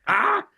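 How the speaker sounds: sudden high cry of dismay; comic yelp. Acted. Joking comedian as Sisyphus under his rock, high yelp. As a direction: yelp as if the load just gave way.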